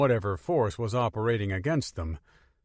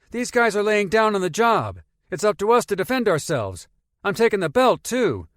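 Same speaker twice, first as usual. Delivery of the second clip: put-on higher, louder young boy; eager boy voice; 10 semitones higher, 7 dB louder than usual. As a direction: play the cocky kid. Boy defends taking belt.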